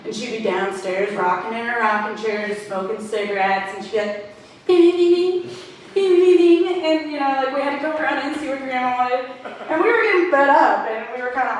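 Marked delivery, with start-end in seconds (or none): 4.7-6.8 high, loud, insistent dinging; imitated bell. Grandma summoning kids with her bell, imitated ringing. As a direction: ring it out rapidly like a handbell. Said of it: "little bell that she'd ring".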